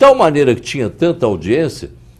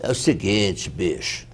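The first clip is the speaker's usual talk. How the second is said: lightly voiced street hustler; a hint of a cocky hustler; voz do malandro; 2 semitones lower, 4 dB quieter than usual. Shown as a demonstration. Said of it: "a voz do malandro". (voz de malandro, de leve)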